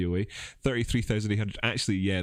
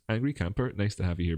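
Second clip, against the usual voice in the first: usual speaking voice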